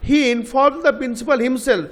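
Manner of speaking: slow, himself given weight; "himself" stressed in a slow read; emphasis on himself. Shown as a demonstration. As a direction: lean on himself at the end. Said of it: "তা আমরা জোর দিচ্ছি".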